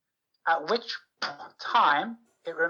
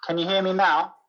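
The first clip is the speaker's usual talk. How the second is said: louder, checking they can be heard; raised voice; 5 dB louder than usual. Real, not acted. Student checking the teacher can hear, raised voice. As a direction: speak up for a listener who can't hear. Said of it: "speak loud, 'cause it's very hard to hear you".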